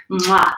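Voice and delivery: affectionate kiss sound; blown kiss. Real, not acted. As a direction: send them a big smooch. Blowing viewers a kiss.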